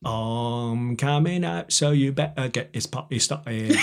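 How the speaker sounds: slow, drawn-out Cockney pop lyric; Cockney accent. Shown as a demonstration. Actor quoting a [name] song for laughs, Cockney accent. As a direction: recite the lyric in comic Cockney. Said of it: "It sounds really funny in Cockney to say".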